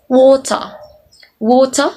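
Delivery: WATER said "waw-tuh", clear T, no R; British crisp T. Shown as a demonstration. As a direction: hit the T in "water" and drop the R. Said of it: "T sounds is clearly pronounced".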